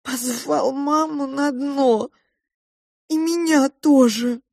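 put-on high, slow childish crying; tearful wail. A narrator's reading. Child telling mouse about guitarist's invitation, crying. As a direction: whine it out high, as if weeping. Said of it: "снова громко заплакала я".

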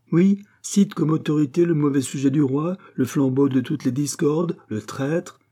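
acted scornful outburst; indignant retort. A narrator's reading. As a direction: spit out the accusations, mildly indignant. Abbé retorts angrily to Pitou.

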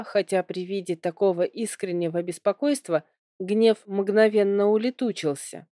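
usual speaking voice

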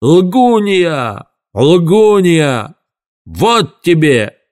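high, drawn-out accusing howl; high-pitched raging cry; furious yell. A narrator's reading. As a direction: wail it high, stretching each word. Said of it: "завопил мистер Мрак".

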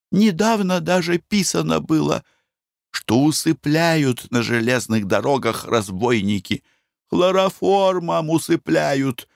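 a nervous wife begging; anxious wife. Acted. Wife's anxious voice.

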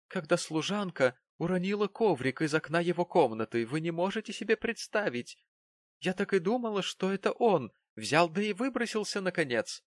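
high, breathless-with-drama retelling; agitated landlady. A narrator's reading. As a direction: relive the fright, pitch climbing.

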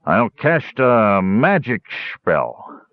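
put-on slow, slurred drunken solemnity. A narrator's reading. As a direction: declare it gravely, very drawn out and tipsy. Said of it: "Karaman was saying solemnly".

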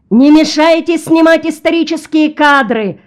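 high, loud shout over a crowd; cameraman shouting. A narrator's reading. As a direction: yell to clear people out of the shot. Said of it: "продолжал кричать кинооператор".

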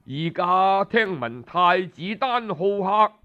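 usual speaking voice

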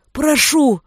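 high, loud begging cry; desperate plea. A narrator's reading. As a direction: beg in agony. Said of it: "Голос предал его".

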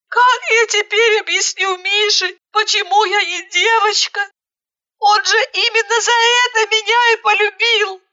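high tearful lament; tearful despair. A narrator's reading. Bride despairing how to explain to Misha, high. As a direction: lament high through tears.

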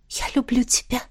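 breathy whispered love. A narrator's reading. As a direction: breathe it close, barely voiced. Whispered confession.

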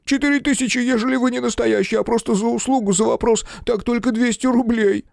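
high, near-shouting panic; almost yell it in panic. A narrator's reading. Photographer keeps babbling.

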